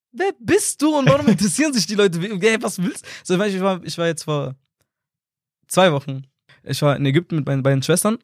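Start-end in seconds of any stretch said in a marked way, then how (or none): 0.1-3.1 high, incredulous mock challenge; imitated baffled siblings. Older siblings doubting little brother's fame, imitated disbelief. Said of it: "Und die denken sich so".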